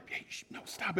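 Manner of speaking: whispered then hushed "Hey, sh-, no"; mock shushing. Parent imitated hushing a noisy kid, whispered and soft. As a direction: hiss the kid quiet like a parent.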